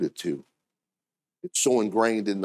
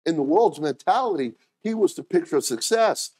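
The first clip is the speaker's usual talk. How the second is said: usual speaking voice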